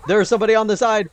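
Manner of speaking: high fast yell of alarm; panicked shout. Real, not acted. Streamer yelling to viewers about a flank, loud panic. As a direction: shout it out, caught off guard. Ambushed by enemy.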